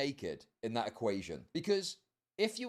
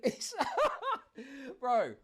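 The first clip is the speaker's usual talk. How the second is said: excited laughing; 11 semitones higher, 3 dB louder than usual. Real, not acted.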